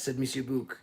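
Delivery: usual speaking voice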